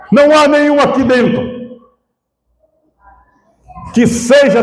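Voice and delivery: high-pitched, fervent pulpit delivery; impassioned preaching. Real, not acted. Pastor telling the congregation of the fold, impassioned preaching. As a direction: preach it out in long, ringing phrases.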